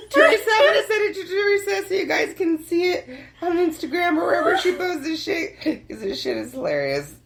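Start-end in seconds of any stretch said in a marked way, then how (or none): 0.1-5.6 still laughing. Real, not acted. Still laughing after joke.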